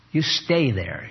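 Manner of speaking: normal talking voice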